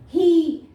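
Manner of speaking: ही loud, with a held "ee"; long E demo. Shown as a demonstration. Teacher giving pupils an example, ही with long E. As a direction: stretch the open vowel in ही. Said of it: "इसलिए long sound दे रहा है".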